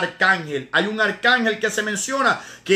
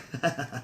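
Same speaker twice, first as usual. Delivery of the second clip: risa breve y suave; 8 semitones lower, 12 dB quieter than usual. Real, not acted. Se ríe del cumplido de hermana.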